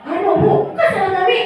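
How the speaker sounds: lightly voiced child protesting; defensive pupil. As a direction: rush to deny the blame. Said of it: "dia pakai gini".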